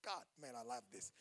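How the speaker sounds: normal talking voice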